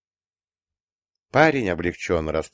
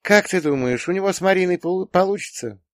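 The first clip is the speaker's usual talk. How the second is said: high, hesitant daughter's question; pensive; 12 semitones higher than usual. A narrator's reading. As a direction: wonder aloud in a raised girl's voice. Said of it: "задумчиво протянула девушка". (задумчиво, высоким девичьим голосом)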